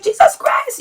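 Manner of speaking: high, thin mock exclamation; dramatic mother. Daughter re-enacts mom's shock, dramatic high exclamation. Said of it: "Jamaican people are dramatic".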